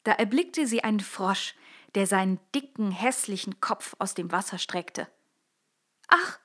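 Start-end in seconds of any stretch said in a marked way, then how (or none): 6.1-6.4 put-on a young princess, upset and pleading. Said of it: "sagte sie".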